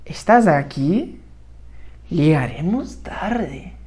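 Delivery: slow, drawn-out Spanish example reading; stretched words, dropping low at the end; slow repeat. Shown as a demonstration. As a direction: read it out slowly for learners. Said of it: "De novo".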